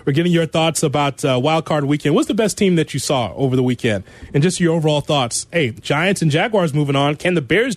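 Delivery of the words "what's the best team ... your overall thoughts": usual speaking voice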